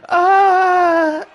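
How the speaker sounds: high loud yelp; mock strained yell. Retelling the match to co-host, high pained yelp. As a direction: mimic her pained cry. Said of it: "she said".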